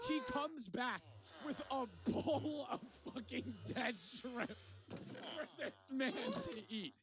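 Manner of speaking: slow, high, laugh-choked telling; laughing through words. Real, not acted. Telling of the bowl for this man, high and laughing.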